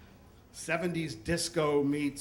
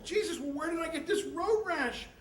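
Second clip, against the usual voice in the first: high, incredulous re-enactment; acted-out bewilderment; exasperated question; 10 semitones higher, 3 dB quieter than usual. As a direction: ask in disbelief, pitch way up. Injured man questions his friend.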